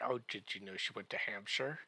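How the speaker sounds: normal talking voice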